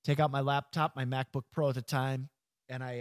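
normal talking voice

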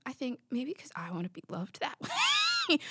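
soft whisper, almost to herself; whispered confession. Real, not acted. Host admitting she wants to be loved, whispered. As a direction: let the truth slip out in a whisper. Confessing why love moves her.

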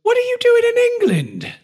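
high, loud mock British accent. Mock British accent as Sir Galahad taunting. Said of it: "My best British accent there".

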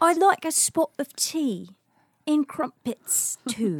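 slow, high hammed-up English accent; a bad, prim English tea order; mock English accent. Playing an accent guessing game, slow high mock English accent. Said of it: "Tea and crumpets would be English".

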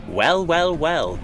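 high, loud mock purr; purring villain. Villain gloating over the fallen reindeer, a loud purr. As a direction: savour the moment, teasing. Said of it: "purred the normal-aged man".

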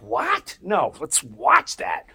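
sudden high "What?", then slow and loud "No, let's watch that."; excited. Retold or imitated. Telling the story of the chimp video, eager to watch. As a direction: jump at the offer, then drag out the yes.

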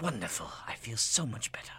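defeated aside under the breath; sarcastic whisper. Acted. Boss whispering sarcastically to himself after the waiter fails.

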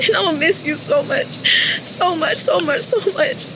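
high, broken, sobbing through words; crying out love and sorry; tearful goodbye. Real, not acted. Daughter's last goodbye to father.

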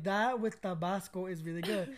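Tabasco with Spanish vowels, relaxed and slow. Retold or imitated. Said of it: "I was like".

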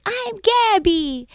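put-on high, slow small-child voice; stretched-out tiny girl impression; imitating a little girl. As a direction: say it like a little kid introducing herself, high and drawn out. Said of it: "And the girl's like".

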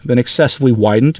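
usual speaking voice

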